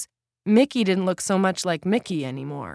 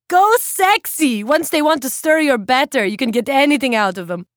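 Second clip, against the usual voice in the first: loud, high boozy cheer; tipsy old woman; 9 semitones higher, 8 dB louder than usual. A narrator's reading. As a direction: egg her on drunkenly.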